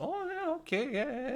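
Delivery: very high, squeaky retold reaction; comic high-voiced yeah-yeah; mock agreement. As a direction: go way up in pitch, humouring. Acts out awkward reaction to remark.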